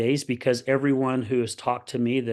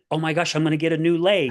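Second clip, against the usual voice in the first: put-on quick, higher-pitched kid's excitement; retold excited gushing; imitating excited kid; 4 semitones higher, 3 dB louder, faster than usual. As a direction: rush it out like a thrilled kid. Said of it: "He was all excited".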